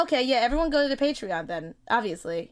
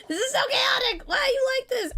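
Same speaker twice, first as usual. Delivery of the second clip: high laughing whine; 12 semitones higher than usual. Real, not acted.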